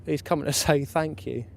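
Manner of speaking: ordinary delivery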